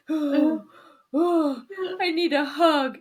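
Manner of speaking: slow, high whimpering mock cry; mock breakdown. Host imitates woman asking partner for comfort, hammed-up crying. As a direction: draw it out like a tearful plea. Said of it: "Full breakdown".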